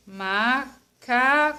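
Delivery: "Macaco" drawn out slowly as ma, ca, co; syllable by syllable. Shown as a demonstration. Teacher splitting macaco for pupils, syllable by syllable. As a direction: separate "Macaco" into three slow beats. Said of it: "Vou falar devagar essa palavra".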